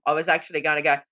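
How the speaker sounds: ordinary talk, nothing marked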